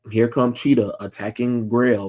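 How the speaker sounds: normal talking voice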